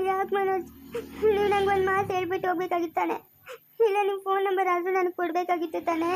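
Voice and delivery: tearful, wailing reproach; weepy accusing whine; crying. Acted. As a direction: sob out a hurt complaint. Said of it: "ಯಾಕೆ ಅಳ್ತಿರೋದು ನೀನು".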